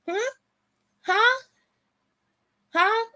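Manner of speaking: sharp high "Huh?" each time, goading; mocking taunt. Goading Xavier Woods in an imagined promo, mocking high taunt. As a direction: needle him, pitch jumping up.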